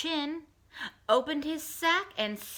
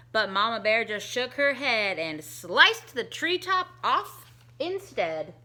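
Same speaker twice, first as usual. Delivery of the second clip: usual speaking voice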